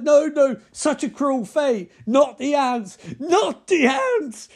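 high, loud, hammed-up anguish; mock-tormented cries, drawn out at the end; Nicolas Cage. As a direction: wail it in over-the-top movie panic. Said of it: "he does his best Nicolas Cage".

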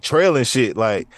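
normal talking voice

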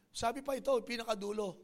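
normal talking voice

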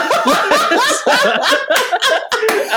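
a high, squealing burst of laughter. Real, not acted. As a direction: crack up at your own joke, way up high. Cracks up at own story.

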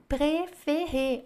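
préférée stretched, three clear é sounds; teacher modelling French; slow French model. Shown as a demonstration. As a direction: draw out préférée slowly. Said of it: "Olha a quantidade de Es".

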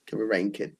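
mock Northampton accent on "Coming rain, kid", "coming in" slurred to "coming rain"; lightly put-on Northampton voice saying "Coming rain, kid". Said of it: "That's my Northampton accent".

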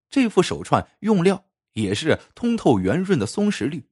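usual speaking voice

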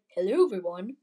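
a slightly put-on British hello; British accent. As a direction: imitate your own slip into a British accent. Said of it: "little, uh, British accent".

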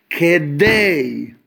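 kedei held long and pressed down; stressed kedei. Shown as a demonstration. Teacher stressing kedei to his listeners, drawn out and pressed. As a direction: lean hard on kedei and stretch it. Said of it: "Let's emphasize the word kedei".

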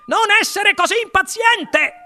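very high calling rebuke; Wonka grida. A narrator's reading. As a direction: scold by calling back, high-pitched. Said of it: "gridò il signor Wonka già lontano". (rimprovero gridato, acutissimo)

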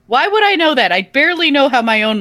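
loud, high, indignant question. Real, not acted. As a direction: fire back in disbelief. Indignant reply.

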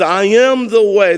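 firm, weighty declaration of I am the way; emphatic. Shown as a demonstration. Preacher declaring Jesus's words to Thomas, emphatically. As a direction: land each word of "I am the way" as final. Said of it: "This is emphatic, absolute".

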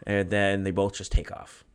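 ordinary talk, nothing marked

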